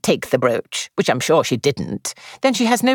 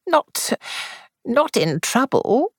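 worried; 11 semitones higher, slower than usual. A narrator's reading. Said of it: "her mind leaping to the worst".

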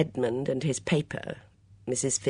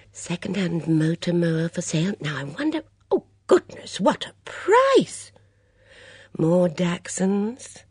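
put-on high, lively voice of a fussy older lady; a bright, gossipy mother at breakfast; chatty Mrs Swettenham; 5 semitones higher than usual. Swettenham reading ads.

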